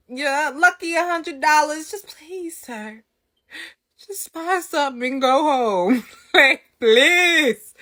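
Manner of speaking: high, whiny mock plea; exasperated begging. Acted begging customer to leave.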